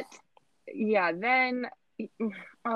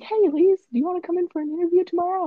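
much higher, louder, quick chirpy greeting; cheery bishop voice; 12 semitones higher, 5 dB louder, faster than usual. Retold or imitated. Bishop calling to teen from his car, chirpy high greeting. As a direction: chirp it like an overly friendly bishop. Bishop pulls up beside her.

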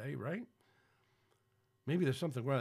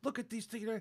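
mock fretting wife, higher and in a changed voice; a hammed-up impression of his upset wife; upset Lori; 9 semitones higher than usual. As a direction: squeal it like she's fussing over the news. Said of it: "she gets really upset".

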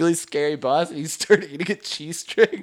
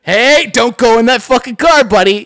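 louder, higher warning shout; calling out; 8 semitones higher, 10 dB louder than usual. Retold or imitated. Warning a shady guy off his open car. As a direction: yell at the stranger to stay away. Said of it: "this dance of, like".

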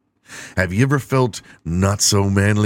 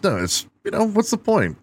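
much higher, dismissive what's the point; high shrug. Real, not acted. Comedian shrugs off the online troll, much higher.

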